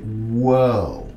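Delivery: low, slow astonished whoa; mock amazed whoa. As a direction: drag it out, stunned and low. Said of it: "I'm like".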